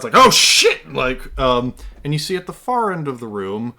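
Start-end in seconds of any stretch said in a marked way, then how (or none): 0.2-0.9 loud, high startled exclamation; startled shout. Acted. Game master voicing someone caught off guard, loud surprised yell. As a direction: blurt it loudly in surprise. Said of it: "a pretty also surprised".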